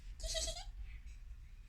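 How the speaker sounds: high, thin soft giggle; sly tittering hee-hee; quiet high-pitched chuckle; Anansi's laugh. A narrator's reading. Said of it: "Anansi laughed".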